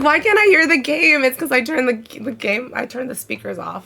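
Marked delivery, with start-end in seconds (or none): 0.0-1.2 laughing retold question. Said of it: "I was like".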